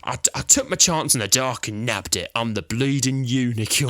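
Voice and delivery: hammed-up fake Cockney, bleeding stretched out; fake Cockney accent.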